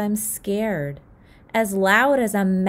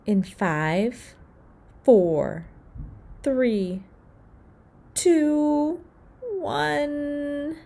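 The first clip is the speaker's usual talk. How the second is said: scared countdown; 7 semitones higher, much slower than usual. Acted. Counting before letting go.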